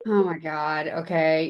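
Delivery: low, unimpressed imitation of a friend; gently mocking. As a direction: humour an overexcited friend, voice dropped. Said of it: "kinda made fun of me".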